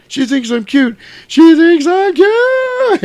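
high elated cry building to a drawn-out yell; overjoyed, building. Retold or imitated. Rudolph overjoyed, repeats and flies off.

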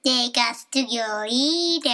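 robotic alien, stretched final word; put-on alien voice. Shown as a demonstration. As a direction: make a demand as a visiting alien. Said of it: "Let out your inner alien voice".

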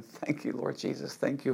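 whispered prayer. Real, not acted. Preacher drops to a whispered prayer of thanks. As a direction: breathe the prayer almost silently. Quiet prayer inside sermon.

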